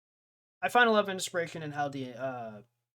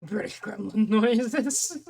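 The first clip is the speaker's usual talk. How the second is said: laughing; 6 semitones higher, 9 dB louder, slower than usual. Real, not acted. Laughing aside about British gremlins.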